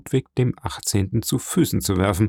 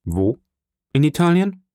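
urgent questions, leaping up in pitch; a king's hasty query; quick questioning; 6 semitones higher than usual. A narrator's reading. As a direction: fire off the question, pitch jumping high at the end. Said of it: "fragte rasch der König".

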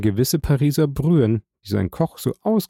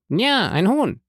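high, eager agreement in character; eager hungry prisoner; 10 semitones higher than usual. A narrator's reading. Hungry banker confirming his order to the guard, eager. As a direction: agree eagerly, pitch jumping up. Starving Danglars agrees impatiently.

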